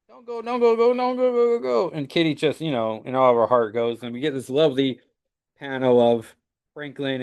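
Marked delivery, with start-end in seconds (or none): 0.1-2.0 put-on high, pleading child's cry; a little boy calling her back; child begging. Franklin pleads with fading Kitty, high desperate child's voice.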